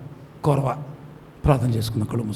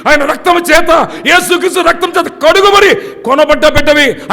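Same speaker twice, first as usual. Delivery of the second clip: high, loud, ringing pulpit cries; preaching at full force; 8 semitones higher, 9 dB louder than usual. Acted. Pastor crying out to grieving believers, loud and high. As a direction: build to shouted pleas.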